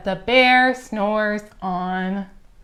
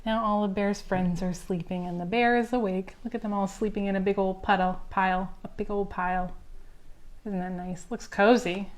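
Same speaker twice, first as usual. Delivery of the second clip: gentle hush; 2 semitones lower, 10 dB quieter, faster than usual. A narrator's reading. Friends asleep, story winds down.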